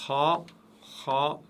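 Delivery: "ha" with a dark, full vowel; heavy Arabic ha. Shown as a demonstration. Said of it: "qui se prononce toujours lourde".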